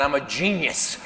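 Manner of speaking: ordinary talk, nothing marked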